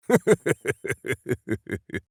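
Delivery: big booming hero's laugh; hearty laugh. Acted. Minsk laughing heartily with Boo, hearty laugh. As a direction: laugh loud and full-bellied.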